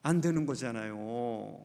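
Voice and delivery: 안 되는 거잖아요 said low, soft and slow, as a grave aside; hushed, sober conclusion; quiet, low. Real, not acted. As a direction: drop the voice to answer your own question. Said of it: "하면 어떻게 되는 겁니까?".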